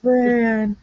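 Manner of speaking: hammed-up dramatic name call; exaggerated. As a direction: overact it like a soap star. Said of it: "It's so exaggerated".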